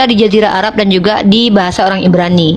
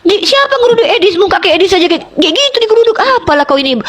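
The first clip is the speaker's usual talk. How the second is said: high, scornful mock indignation; heated ridicule, high; mocking outburst; 7 semitones higher than usual. Real, not acted. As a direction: jeer at the name, pitched up. Mocking outburst about Edis.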